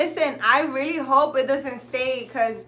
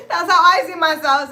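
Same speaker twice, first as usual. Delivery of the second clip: laughing loud and high through it; 6 semitones higher, 11 dB louder than usual. Real, not acted. Cracking up at her own goddess fantasy, laughing loud. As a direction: crack up as you repeat it.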